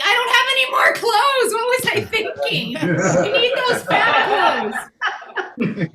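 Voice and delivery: high loud panicky wail; comic shriek of clothes panic; mock panic. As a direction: shriek it like the closet betrayed you.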